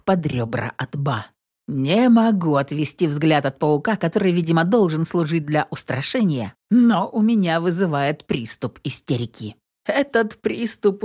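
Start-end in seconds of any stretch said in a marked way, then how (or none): none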